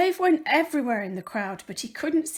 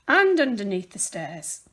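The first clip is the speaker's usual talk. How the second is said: ordinary delivery, nothing marked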